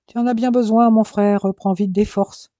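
high, loud gleeful jibe; needling big-brother tease; mocking tease. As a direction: laugh at him through the words. Mocking brother over orange juice.

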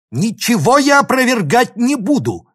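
high, loud angry bark; barked refusal. A narrator's reading. Professor rejects student's demand, barking in anger. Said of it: "рявкнул профессор".

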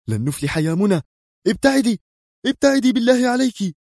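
high, pleading shout to get away; urgent cry. A narrator's reading. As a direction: yell at her to flee. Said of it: "وهتف إبراهيم". (هتاف مستعجل عالٍ)

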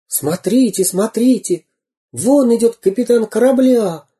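высокий звонкий мальчишеский крик; закричи, как взволнованный мальчишка; кричащие мальчишки. Acted. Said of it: "закричали мальчишки". (мальчишки кричат, завидев пирата: высокий возбуждённый крик)

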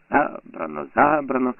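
spiteful mocking echo, rising on the second; mocking mimicry. A narrator's reading. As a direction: mimic him with fury. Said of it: "передражнює, лютуючи".

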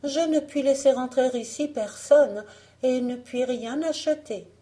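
put-on high-pitched fairy-tale girl; refusing young heroine. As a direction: politely refuse in a lifted voice. Blanche refuse d'acheter.

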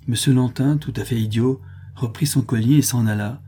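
plain reading voice

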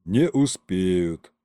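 low, slow, tired sigh; drawn-out weary resignation; weary exhale. A narrator's reading. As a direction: breathe it out as if giving up. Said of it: "устало выдохнул Колымдай".

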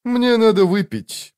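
slow, high-pitched grumble; weary muttering. A narrator's reading. Weary man tells his friend he needs drink, grumbling. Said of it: "пробормотал Мурилью".

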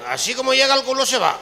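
hammed-up emphatic repeat. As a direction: land the joke harder. Punchline repeated, louder.

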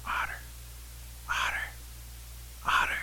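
whispered call to a sleeper; hushed, voiceless calling; whispering. A narrator's reading. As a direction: whisper the name, pausing between calls. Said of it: "Rabbit starts whispering over to Otter".

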